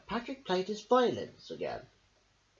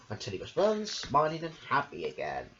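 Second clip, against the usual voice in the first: ordinary delivery, nothing marked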